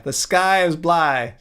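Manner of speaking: "blue" said as "bly", slowly; slow "bly" rhyming with sky; mock mispronunciation. Streamer mocking the doctor, slow "bly" for blue. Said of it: "though, he said".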